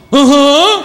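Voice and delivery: hammed-up vacant uh-huh; dumb viewer. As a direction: play the gullible TV viewer. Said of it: "we're all at home watching T V going".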